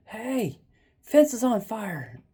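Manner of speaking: high, drawn-out alarm call; retold screaming. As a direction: imitate hollering a warning to a neighbor. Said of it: "and I started screaming".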